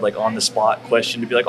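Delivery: ordinary talk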